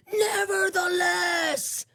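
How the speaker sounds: high, very slow, drawn-out declaration; imitating a preacher overruling all objections; prophetic proclamation. Woman cutting off the inmate's doubts, prophetic proclamation.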